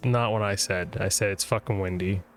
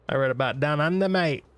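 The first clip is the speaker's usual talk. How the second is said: mock Australian; 5 semitones higher than usual. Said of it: "fellow Australian".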